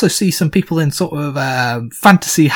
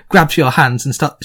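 ordinary talk, nothing marked

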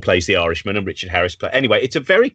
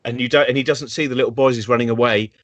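ordinary talk, nothing marked

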